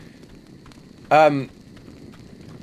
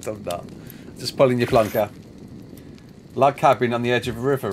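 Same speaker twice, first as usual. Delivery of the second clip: ordinary delivery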